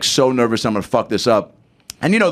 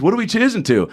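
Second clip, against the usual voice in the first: [name]; 9 semitones higher than usual. Retold or imitated. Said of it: "he goes".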